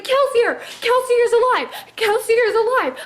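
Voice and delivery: high, loud, thrilled cries; excited shout. Retold or imitated. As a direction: shout the name like a fan seeing a hero return. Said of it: "I was so excited".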